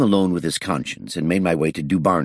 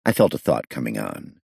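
ordinary delivery, nothing marked

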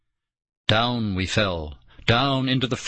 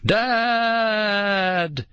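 long, high, rising calls; screaming at top of lungs; 13 semitones higher than usual. A narrator's reading. Girl screaming up the pit for her father, full scream. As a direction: scream for him with all you have. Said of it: "screamed at the top of her lungs".

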